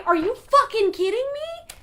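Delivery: over-the-top indignant shout; hammed-up outrage. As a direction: yell it like a cartoon hero losing it. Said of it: "He says".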